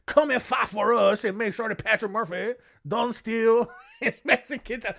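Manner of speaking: put-on laughing through a botched Spanish accent; Godfather-style mock Spanish accent, giggling; bad Spanish accent. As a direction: break up laughing while doing a terrible Spanish accent. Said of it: "That is not even close to a Spanish accent".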